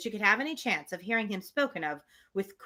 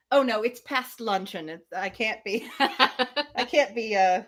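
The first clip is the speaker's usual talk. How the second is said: mock Emma. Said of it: "it really does sound like she's saying".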